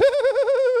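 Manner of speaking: high, loud squealed tickle sound; imitated tickling noise. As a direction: squeal as you reach in to tickle. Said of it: "he reaches over and goes".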